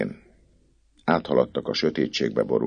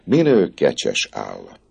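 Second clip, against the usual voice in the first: higher, louder, delighted cry; enthusiastic exclaim; 7 semitones higher, 4 dB louder than usual. Acted. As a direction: gush over the goat with joy. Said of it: "lelkesen felkiáltott". (lelkes, magasabb felkiáltás)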